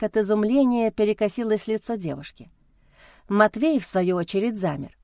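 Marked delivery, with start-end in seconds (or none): none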